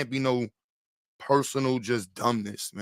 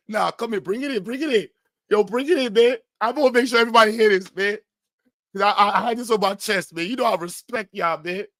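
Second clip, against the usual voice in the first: raised, eager voice calling everyone in; rally the room before the big confession; a pumped-up buddy gathering the crew; mock heartfelt speech; 7 semitones higher than usual. Acting out Willie's speech.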